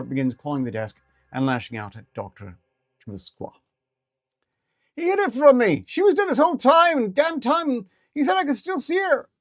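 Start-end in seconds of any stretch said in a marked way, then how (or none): 5.0-9.3 very high, loud ferret rant; angry ferret. Acted. Ferret raging at interviewer about dead owner, loud. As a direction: rage at the lie, high.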